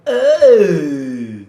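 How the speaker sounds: loud, drawn-out falling "oh"; mock wail. Host scoffs at promised great games, loud mock wail. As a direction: let out a big hammy wail.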